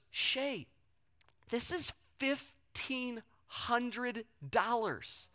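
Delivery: hushed, very slow, word by word; stunned announcement. Retold or imitated. Wife's stunned announcement, retold.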